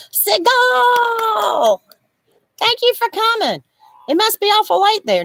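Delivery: high, drawn-out call of the name; excited greeting. Real, not acted. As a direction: hail a friend with delight. Greeting late-night viewer.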